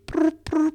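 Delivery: high mock ringtone trill; phone ringing. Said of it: "I'm gonna call him".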